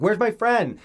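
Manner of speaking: high, loud mock demand; hammed-up indignant outburst; angry demanding. Momo cursing at her grandma, angry demand. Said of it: "she's just cursing at her".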